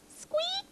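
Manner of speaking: one tiny, very high squeak; high pretend peep; mouse squeak. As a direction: answer as a little toy mouse. Toy mouse replies.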